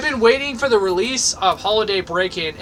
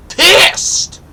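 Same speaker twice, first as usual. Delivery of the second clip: angry yell; 12 semitones higher, 9 dB louder than usual. Real, not acted. Annoyed at game.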